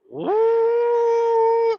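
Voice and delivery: high, loud "woo" like the fan kicking on; imitated fan whoosh. Telling about his tiny welder, imitates the fan: high whoosh. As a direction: mimic the fan roaring to life.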